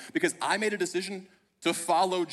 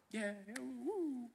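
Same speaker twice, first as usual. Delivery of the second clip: a slow, limp mock cheer, softer; half-hearted cheer; 7 semitones higher, 12 dB quieter, much slower than usual. Watching baptisms from the back, a slow half-hearted cheer. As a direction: cheer like you're only half into it. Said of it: "you're like".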